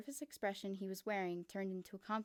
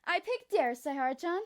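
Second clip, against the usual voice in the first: Kokichi's voice; 6 semitones higher, 9 dB louder than usual. Acted. Kokichi answers in character.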